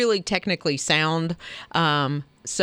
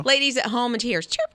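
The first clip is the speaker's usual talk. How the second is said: ordinary talk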